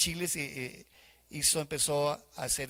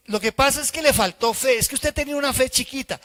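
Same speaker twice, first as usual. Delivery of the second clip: higher, louder sing-song reproach; mock accuser; 8 semitones higher, 7 dB louder than usual. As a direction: tease like a know-it-all blaming small faith. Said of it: "uno dice". (reproche burlón, agudo y cantado)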